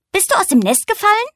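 hohe, piepsige Igelstimme; besorgter Igel. Acted. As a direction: frag hoch und besorgt wie ein kleiner Igel. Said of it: "fragte der Igel". (der Igel fragt die weinende Eule, hoch und besorgt)

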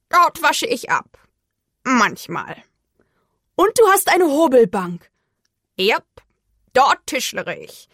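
put-on crow voice, matter-of-fact; self-important crow. A narrator's reading. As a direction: answer as a crow showing off its home. (wichtigtuerische Krähenstimme)

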